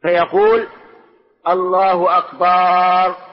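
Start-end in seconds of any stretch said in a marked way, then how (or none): 1.5-3.3 "أكبر" drawn out slowly as "akbaaar"; stretched ba. Shown as a demonstration. Teacher showing students the forbidden takbir, "أكبر" with a long stretched "baaa". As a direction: hold a long "aa" after the ب of "أكبر". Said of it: "أن يمد الباء".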